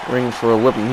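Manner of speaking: ordinary talk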